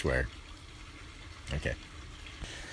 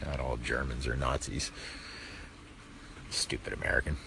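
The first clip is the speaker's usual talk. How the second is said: mock German accent; 7 semitones lower, 12 dB quieter, faster than usual. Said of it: "I almost want to speak with the, the, the German accent".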